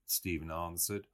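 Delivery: ordinary delivery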